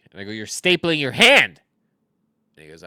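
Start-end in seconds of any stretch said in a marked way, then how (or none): 0.3-1.6 retold yell, louder and higher; shocked shout. To the coworker stapling his hand, an imitated alarmed yell. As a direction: blurt it out in disbelief.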